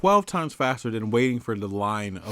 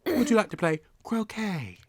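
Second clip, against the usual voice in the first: high posh invitation; 9 semitones higher than usual. Pretend.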